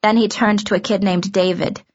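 ordinary delivery

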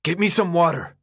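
high, irritated yelled order; exhausted, exasperated shout; raw-voiced yell. A narrator's reading. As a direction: yell the demand with a raw throat. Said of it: "Minho yelled, his voice raw".

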